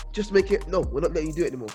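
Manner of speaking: ordinary talk, nothing marked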